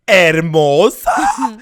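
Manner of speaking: loud, drawn-out cry of a name; mock dramatic call. Veronica walking into her dad's study, hammed-up call. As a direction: stretch it out high and loud, hammed up.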